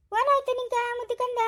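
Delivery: high-pitched doting coo; baby talk. Acted. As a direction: coax the crying baby in a high singsong. Cooing to a crying baby. (ಮಗುವಿನೊಂದಿಗೆ ಮುದ್ದು ಮಾತು)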